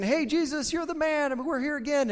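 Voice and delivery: high, eager crowd voice; high-pitched eager hanger-on. Pretend. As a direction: pitch up like excited fans. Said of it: "I picture them kind of saying".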